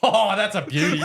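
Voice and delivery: high, loud, laughing mock delight; amused delight. Shoe rep relishing the prank, amused.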